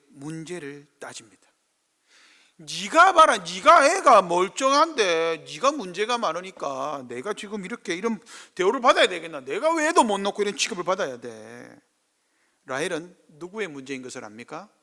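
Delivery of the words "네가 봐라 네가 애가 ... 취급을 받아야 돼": loud, high, accusing outburst; Rachel's complaint. Acted. Rachel shouting blame at Jacob, loud and high.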